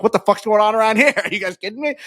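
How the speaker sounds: high-pitched retold disbelief; mock incredulous outburst. Host re-enacting sprinting up to the review, incredulous. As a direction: blurt it out high, acting out disbelief.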